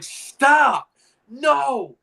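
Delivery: loud, high-pitched stop; retold yelp of horror; mock horrified outcry. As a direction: cry out to halt her. Acted refusal of Nana's proposition.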